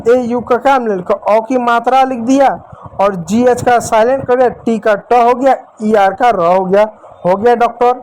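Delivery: normal talking voice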